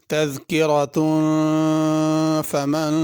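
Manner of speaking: slow, drawn-out melodic tajweed chant; Quran recitation. Acted. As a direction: recite slowly with long stretched vowels.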